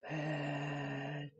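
very slowly. Shown as a demonstration. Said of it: "řekneme to strašně pomalinku".

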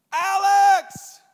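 high shouted name, calling after him; mock desperate yell. Woman calling after her ex, high desperate yell. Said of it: "she yelled".

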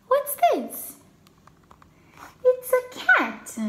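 ordinary delivery, nothing marked

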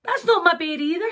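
high-pitched, protesting little voice; indignant Sarah. Acted. Sarah telling the pony it's the wrong bear, indignant. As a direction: object in a high voice.

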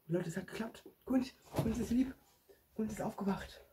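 shouting to the viewers that it worked; overjoyed. Pretend. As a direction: burst out with joy. Surgery worked, joyful outburst.

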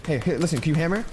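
ordinary talk

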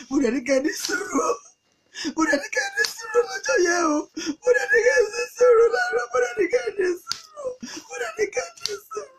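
very high, quick keening cry; shrill falsetto sobbing plea; wailing lament. Acted. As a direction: wail in acted grief, pleading. Acted mourner laments the dead man.

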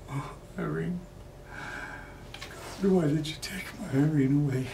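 soft, high grieving cry after a sigh; mournful lament. Acted. Bereaved man asking why Irene was taken, mournful. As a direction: ask it helplessly, drained by grief.

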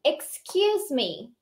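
slow, careful English for repeating; slow model reading. Shown as a demonstration. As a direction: model the line slowly for students. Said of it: "Primeira parte do diálogo diz assim". (leitura lenta para repetir)

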